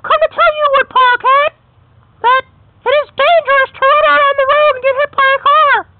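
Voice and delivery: very high chirpy little bird voice; squeaky bird voice. Acted. Little bird warning the cat, very high chirpy voice. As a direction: pipe it up high like a tiny bird. Bird character Mister Tweeter speaks.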